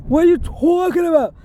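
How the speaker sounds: mock nasal-free caricature of his imitation; imitating a mocking voice. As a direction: mimic the guy mocking how she talks. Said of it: "I don't sound like that".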